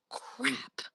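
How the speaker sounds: a sudden voiceless whisper of alarm; panicked whisper in a dorm of sleeping people; whispered curse. Retold or imitated. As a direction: hiss the word under your breath so no one wakes. Retold panicked realisation in hostel.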